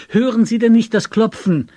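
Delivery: loud, high exasperated question; agitated shout. A narrator's reading. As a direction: yell at someone who won't wake. Agitated friend shouts about knocking.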